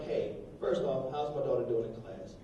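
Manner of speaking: quietly controlled opening; restrained parent. Retold or imitated. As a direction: keep it down and measured with the teacher. Father calmly opening teacher conference.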